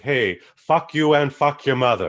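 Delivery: loud mock Russian insult; mock Russian accent. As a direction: fire back a hammed-up Russian insult. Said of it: "I would like".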